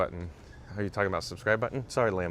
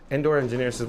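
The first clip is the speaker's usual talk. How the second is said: normal talking voice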